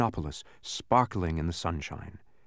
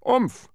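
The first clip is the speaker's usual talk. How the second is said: short, high grunt; loud winded grunt; 12 semitones higher, 5 dB louder than usual. A narrator's reading. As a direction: grunt as if knocked in a heap. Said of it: "grunted Tock".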